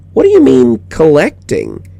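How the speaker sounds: put-on high, loud puzzled child's voice; a kid confused by the word; little girl. A narrator's reading. Child questioning.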